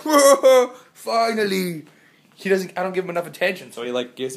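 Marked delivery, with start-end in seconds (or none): 0.0-1.9 voicing the dog. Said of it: "He was like".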